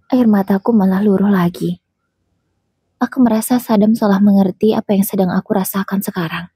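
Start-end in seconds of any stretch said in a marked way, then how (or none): none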